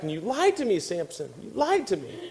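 mock a wife's reproach, swooping up on LIED; nagging wife. Acted nagging wife's accusation.

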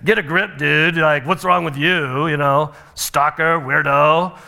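lightly voiced surfer chick; dismissive surfer girl. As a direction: brush him off like a bored surfer girl. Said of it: "they're like".

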